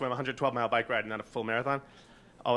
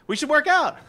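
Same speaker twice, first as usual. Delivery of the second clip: eager; 13 semitones higher, 9 dB louder than usual. Retold or imitated. David calling eagerly.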